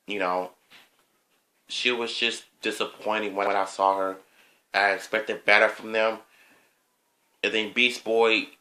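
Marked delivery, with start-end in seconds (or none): none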